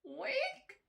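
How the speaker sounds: high, rising squeaky oink; pig's oink. A narrator's reading. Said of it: "said the cats".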